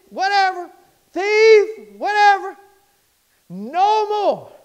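loud, high preacher shout; shouting down the old names; preaching at full force. Acted. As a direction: hammer each old name away.

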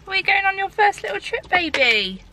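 high, sing-song voice for the puppy. Real, not acted. Owner cooing to her dog Teddy, high baby talk.

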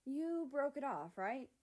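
hopeful. Acted. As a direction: ask with eager hope. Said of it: "Mexico added hopefully".

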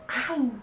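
AI short and quick; brief little ai; intonation demo. Shown as a demonstration. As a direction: cut "ai" off short. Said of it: "Também tem várias entonações".

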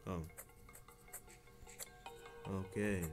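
ordinary delivery

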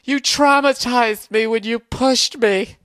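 high, slow, whiny mock victim; sneering impression of a wounded girl; mocking whiny girlfriend. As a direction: mimic her playing the victim, stretched out. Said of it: "she's sitting there going".

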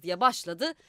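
plain reading voice

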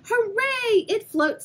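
high, loud cheer of joy; a child's delighted cheer. Acted. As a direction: shout hooray with delight. Parker cheering.

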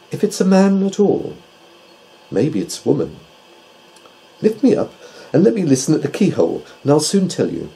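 pondering owl, then eager to listen closer; Tutu the owl. A narrator's reading. Owl asks doctor to lift it to keyhole, high and keen.

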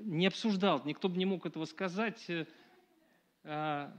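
ordinary delivery

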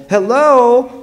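high, loud singled-out greeting call. A narrator's reading. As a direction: call it out to someone down the street. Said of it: "when a voice called".

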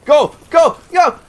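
high, very loud yelps; frantic one-word screams; panicked shouting. Real, not acted. Panicking as boss attacks.

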